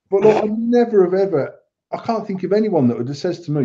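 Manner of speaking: ordinary delivery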